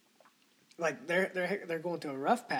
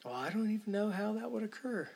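higher, mock-pondering pedant voice; mocking philosopher; 6 semitones higher than usual. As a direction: parody a clueless philosopher, pitched up. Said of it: "modern fucking philosophers are like".

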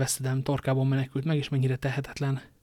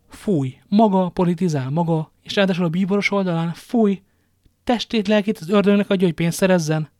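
higher, louder, disgusted wife; furious; 5 semitones higher, 8 dB louder than usual. A narrator's reading. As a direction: spit out her contempt at him. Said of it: "ennyire dühösnek a feleségét". (dühös, undorodó kiáltás)

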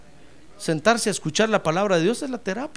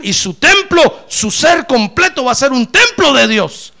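fiery revival preaching, voice ringing out; full-force preaching; 7 semitones higher, 12 dB louder than usual. Acted. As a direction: lift the church with a soaring shout. Preaching climax, temple filled with glory.

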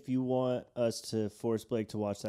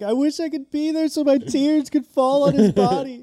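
much higher, louder weepy plea; mock weepy plea; 9 dB louder than usual. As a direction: plead through fake tears. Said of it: "Tommy's like".

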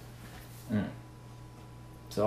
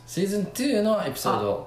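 amazed; 10 semitones higher, 5 dB louder, much faster than usual. Real, not acted. Amazed at episode count.

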